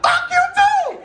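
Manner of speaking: hammed-up comeback; yelled retort. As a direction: top him, shrill with glee. Acted quarrel, his retort.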